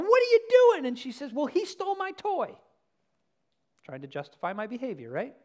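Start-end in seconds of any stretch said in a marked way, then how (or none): 0.0-0.9 high, loud parental shriek; startled mom catching the kids; shocked parent. Retold or imitated. Parent running over to kids. 1.3-2.6 put-on high whiny little girl voice; a child pleading her case; high little girl excuse.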